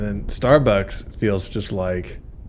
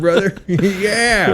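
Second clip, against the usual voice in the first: high, laughing through it; laughing bro voice; 9 semitones higher, 3 dB louder, much slower than usual. Retold or imitated.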